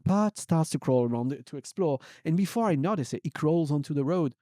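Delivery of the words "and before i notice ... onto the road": ordinary delivery, nothing marked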